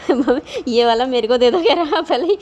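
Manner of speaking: ऊँची आवाज़ में हँसते हुए ज़िद्दी बेटे की नकल; हँसी के साथ बेटे की माँग दोहराओ; हँसते हुए बच्चे की नकल. Retold or imitated. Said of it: "Risu शुरू में ही आ गया था". (बेटे की लड्डू की माँग, हँसते हुए ऊँची आवाज़ में नकल)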